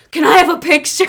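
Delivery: high, loud excited outburst; uncontained fan outburst, high and loud; blurted out. Retold or imitated. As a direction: blurt it out in one eager burst. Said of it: "I literally just blurted out".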